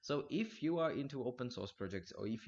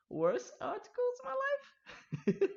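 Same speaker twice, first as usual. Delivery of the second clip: high, slow, laughing through it; can't hold back the giggles; 5 dB louder, slower than usual. Real, not acted. Amused by robot-written article.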